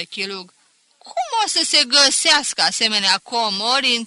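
loud, sarcastic teacher with a sneering laugh; teacher jeering at the boy's essay; mocking teacher. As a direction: jeer at him, slowing on the praise.

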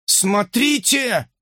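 high, drawn-out alert; urgent exclamation. Acted. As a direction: draw everyone's attention urgently. Guru urgently points at monitor. (взволнованный возглас)

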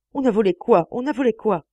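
high, loud bewildered question; frantic demand. Acted. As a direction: demand it in panic. (question affolée)

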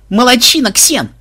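high, loud gush of praise; delighted cheer. A narrator's reading. Granddaughter praising her friend Ксен, delighted yell. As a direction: cheer a friend in utter rapture. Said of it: "в полном восторге завопила внучка".

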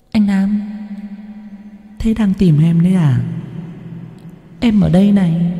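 put-on a dead girl teasing him from nowhere; cold ghostly voice. A narrator's reading. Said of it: "giọng nói lại vang lên". (giọng ma nữ lạnh lùng, cao và nhỏ)

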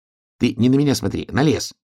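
high, insistent little hedgehog; high-pitched, impatient instruction. Acted. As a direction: correct your friend in a high hedgehog voice. Hedgehog impatiently correcting bear cub.

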